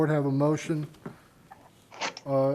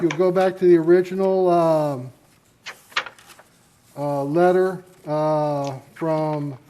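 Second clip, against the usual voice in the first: normal talking voice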